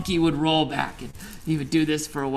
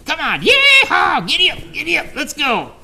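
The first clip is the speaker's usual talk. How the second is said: very high, loud yeehaw, giddy up like a rodeo rider; monkey playing cowboy; 12 semitones higher, 9 dB louder than usual. Monkey riding the ox's back, yelling high and loud. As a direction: whoop the ox along like a cowboy. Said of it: "and say".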